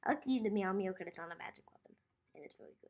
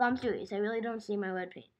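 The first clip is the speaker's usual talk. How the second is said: normal talking voice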